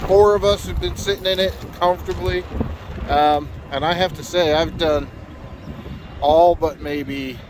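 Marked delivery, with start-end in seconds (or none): none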